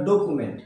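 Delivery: "ডকুমেন্ট" said as "do-ku-ment"; common mispronunciation. Shown as a demonstration. Teacher showing what we usually say, "ডকুমেন্ট" as do-ku-ment. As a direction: say "ডকুমেন্ট" with a plain "ku", no y glide.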